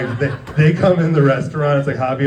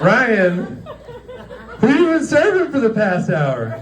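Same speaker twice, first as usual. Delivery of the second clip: higher, alarmed question, laughing; mock panic; 12 semitones higher, slower than usual. Coworker panicking about who Ryan served, mock panic. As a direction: hiss the alarm at him.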